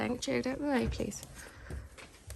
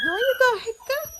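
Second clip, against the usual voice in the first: much higher sing-song coaxing voice; puppy talk; 8 dB louder than usual. Real, not acted. Cooing at puppy.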